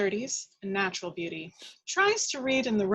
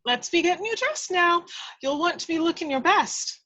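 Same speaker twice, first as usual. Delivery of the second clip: higher, brisk Irish housekeeper bustling; chatty housekeeper; 9 semitones higher, 4 dB louder than usual. Acted. Housekeeper bustling the old lady to get dressed, chatty. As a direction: chatter at her like a busy old maid. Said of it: "The corpulent Irish housekeeper".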